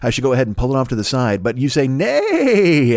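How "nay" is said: high, drawn-out mock whinny; horse neigh. Listeners egging him on as a runaway horse, mock neigh. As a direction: stretch the word into a horse's neigh. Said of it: "as if you were a runaway horse".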